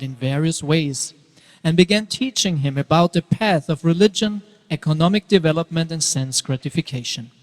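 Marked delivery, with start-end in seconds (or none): none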